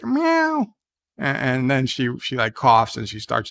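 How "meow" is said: high mock meow of a cat; playful imitated cat meow. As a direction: squeak out a cat's meow, much higher. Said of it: "the cat doctor makes a, a cat, like".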